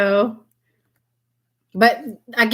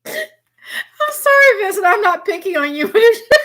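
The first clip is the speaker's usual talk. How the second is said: laughing apology; 12 semitones higher, 3 dB louder than usual. Real, not acted. To her viewer Vincent, still laughing helplessly. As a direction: try to apologize but keep breaking up.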